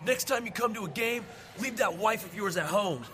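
a touch of a jeering fan at a game; rude heckler. Retold or imitated. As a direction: mimic the insult, mildly hammed-up. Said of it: "He's like".

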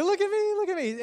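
loud, high squeaky cartoon voice; mock a show-off friend, squealing like a cartoon mouse; Mickey Mouse. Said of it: "he speaks like Mickey Mouse".

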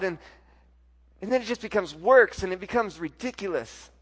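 ordinary talk

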